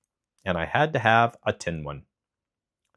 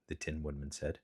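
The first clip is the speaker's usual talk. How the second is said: usual speaking voice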